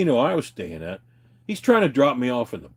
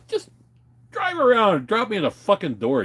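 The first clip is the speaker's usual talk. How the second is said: annoyed demand; 11 semitones higher than usual. Retold or imitated. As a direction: order him to the front door. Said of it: "I'm like".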